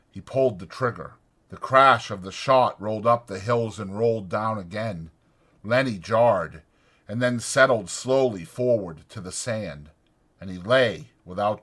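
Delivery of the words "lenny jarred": ordinary delivery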